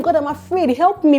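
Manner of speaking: a hint of a frightened prayer; pleading prayer. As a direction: cry out to God, half acted. Acted prayer of fear.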